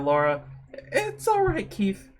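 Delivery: usual speaking voice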